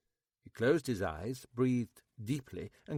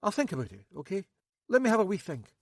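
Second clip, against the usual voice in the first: flustered Max; 8 semitones higher, 5 dB louder, faster than usual. A narrator's reading. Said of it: "slightly flustered".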